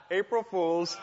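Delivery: gleeful reveal; preacher springing his revenge prank; playful gotcha. Retold or imitated. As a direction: turn the prank back on him. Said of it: "I said".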